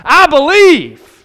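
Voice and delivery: loud, high, slow proclamation; fervent declaration. Acted. Preacher turns the fan's cry into faith, proclaiming loudly. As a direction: belt it out, stretching the last word.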